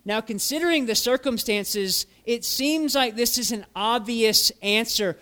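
ordinary delivery

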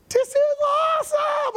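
squealing high with delight; thrilled Haman. Acted. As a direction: gush like he's just won big. Haman thrilled at being invited.

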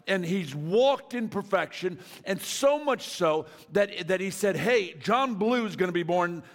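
loud, high, driving sermon voice; preaching at full force. Acted. Preacher driving home the point to the congregation, loud and high. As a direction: preach it out, building fervor.

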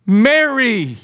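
one ringing word, falling away; Jesus calling Mary. Acted. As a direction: call her name so she knows the voice. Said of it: "Jesus says to her".

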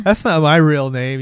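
hammed-up drunk protest; drunk Ryan. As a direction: lean on the words like a drunk. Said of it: "He's all drunk".

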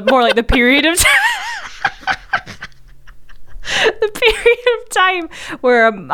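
laughing. Real, not acted. Laughing, drawn-out high voice.

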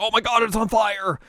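high, loud panicked shout; panicked cry. Retold or imitated. Grandpa seeing smoke at the shrine, mock panicked shout. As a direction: yelp in mock alarm.

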